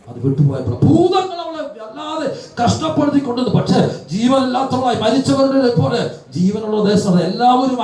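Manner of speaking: fervent preaching. Acted. Preacher telling the congregation about Mary's deliverance, impassioned and high. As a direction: preach it with rising fervour.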